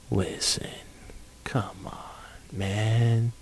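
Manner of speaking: hushed aside; secretive whispered aside. Acted. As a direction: drop to a whisper. Aside to listeners.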